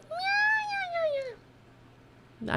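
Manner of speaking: high squeaky meows; imitating a cat. Copying the cat that just walked in, squeaky high meows. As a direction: mimic the cat's tiny meow. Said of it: "he's like".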